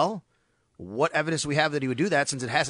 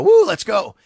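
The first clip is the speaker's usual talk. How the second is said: high, loud touchdown whoop; celebration whoop; 7 semitones higher, 10 dB louder, slower than usual. Retold or imitated. Quarterback getting up after a touchdown, loud excited whoop. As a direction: whoop it up like you just scored.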